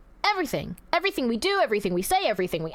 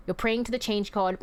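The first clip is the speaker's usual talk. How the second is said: normal talking voice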